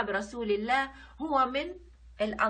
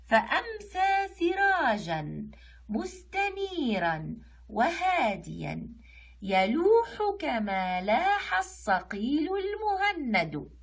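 high, slow, drawn-out verse; poem recitation; 6 semitones higher, much slower than usual. Shown as a demonstration. Teacher reading the next line, slow high recitation. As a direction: recite with long held vowels, pitched up. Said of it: "نقرأ السطر اللي بعده".

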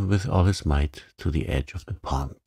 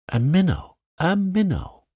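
rising cry of delight, higher on the second; a frog thrilled by his bite; excited cry; 12 semitones higher, 3 dB louder than usual. A narrator's reading. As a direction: shout it out, thrilled at the catch. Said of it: "cried Mister Jeremy Fisher".

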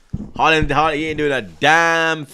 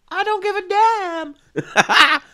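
high, slow, laughing through it; 13 semitones higher, much slower than usual. Real, not acted. Fan laughing off a viewer's jibe, high and slow.

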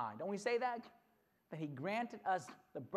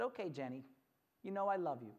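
tender dad; 4 semitones lower than usual. Acted. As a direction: give in gently and lovingly. Dad relents at bedtime.

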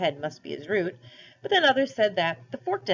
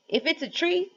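curious bear; 11 semitones higher than usual. A narrator's reading. Other bear wondering.

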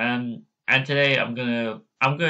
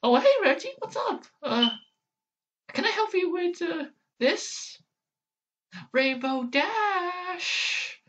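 very high squeaky cartoon voice; Pinkie Pie impression. Vlogger attempting Pinkie Pie, very high squeak. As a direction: go way up, bubbly and squeaky. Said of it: "Now, next is Pinkie Pie".

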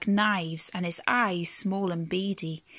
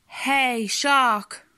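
raised, loud storybook boom; booming whale; 4 semitones higher, 4 dB louder than usual. A narrator's reading. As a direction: boom it out at the shark. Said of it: "boomed the whale".

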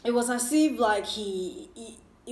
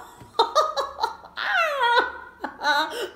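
high burst of laughter; 14 semitones higher than usual. Real, not acted. Laughs at her own outburst.

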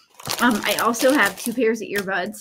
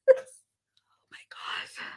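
slow, breathy, voiceless sigh of words; whispered exasperation; 7 dB quieter, much slower than usual. Real, not acted. Crafter to viewers after her clumsiness, whispered exasperation. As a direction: let it out under your breath. Laughs after poking own eye.

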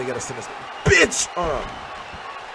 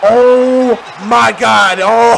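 stunned; 8 dB louder, much slower than usual. Real, not acted. Narrowly escaping defeat.

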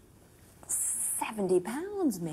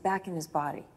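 ordinary talk

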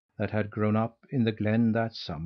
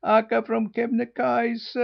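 high, pitiful wailing gosling voice; 12 semitones higher, 5 dB louder, slower than usual. A narrator's reading. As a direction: wail the leader's name pitifully. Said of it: "wailed the goslings pitifully".

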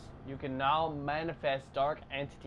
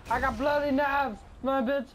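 excited yelling; 9 semitones higher than usual. Real, not acted. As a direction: yell it out in triumph. Yelling after getting knives.

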